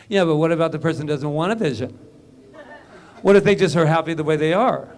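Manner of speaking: loud, quick skeptical challenge; a hammed-up naysayer pushing back; mock objection. Skeptic's objection voiced aloud.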